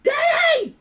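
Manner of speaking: very high, loud cry for Dad; full yell. Retold or imitated. Daughter who can't get up calls her father, full high yell. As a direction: scream across the house for your father. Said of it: "I'm yelling".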